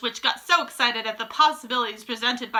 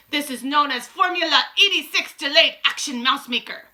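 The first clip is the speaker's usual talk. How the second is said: commanding witch, higher and louder; Grand High Witch; 5 semitones higher, 5 dB louder than usual. A narrator's reading. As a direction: proclaim it as the ruling witch.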